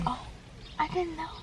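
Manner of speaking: hushed. Real, not acted. Hushed near open window.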